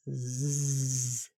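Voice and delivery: soft, distant buzzing hum; a quiet bee's zzz; buzzing bee sound. Acted. Bees' wings make a buzz.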